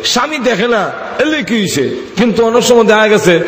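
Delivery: high, measured sermon call; preaching. Acted. Preacher applying the story to women here, high preaching.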